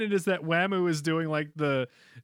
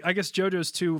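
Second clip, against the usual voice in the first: usual speaking voice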